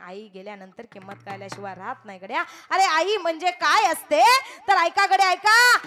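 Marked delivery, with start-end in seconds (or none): 2.7-5.8 loud and higher, calling the crowd to listen; full-force kirtan preaching. Acted. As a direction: preach it out to the whole hall. (जोरात कीर्तनाचा उपदेश)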